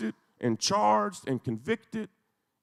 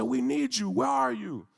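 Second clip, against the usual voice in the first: heartfelt plea; 8 semitones higher than usual. Retold or imitated. Grandmother pleads with Turkey's president, aching and higher. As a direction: pour out lifelong devotion, begging. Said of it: "She said".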